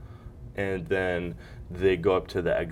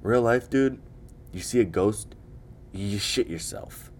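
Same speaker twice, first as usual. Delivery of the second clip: ordinary talk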